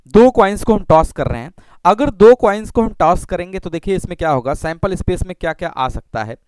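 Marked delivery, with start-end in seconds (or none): none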